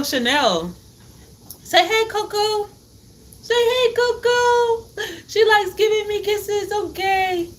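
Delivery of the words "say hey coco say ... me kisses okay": put-on high cooing baby talk to a dog. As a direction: coo at the puppy in a high voice. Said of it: "That's my baby voice".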